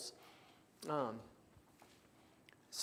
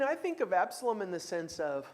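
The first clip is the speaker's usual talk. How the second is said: normal talking voice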